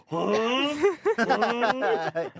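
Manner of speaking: high, puzzled mock "huh"; imitating startled friend. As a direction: act out his caught-in-the-act yelp, high-pitched. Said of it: "he just goes".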